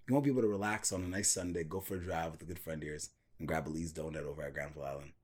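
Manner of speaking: low, quiet lifestyle-ad voice; soft ad voice. Pretend. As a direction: murmur it like a cosy ad. Mock mellow ad voice.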